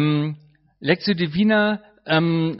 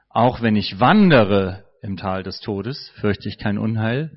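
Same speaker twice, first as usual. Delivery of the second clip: "wandere" lifted and leaned on, low voice; stress on wandere; 6 semitones lower than usual. Shown as a demonstration. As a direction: Betonung auf "wandere". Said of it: "mit verschiedenen Betonungen verschieden lesen".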